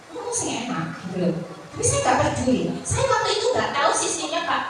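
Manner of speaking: usual speaking voice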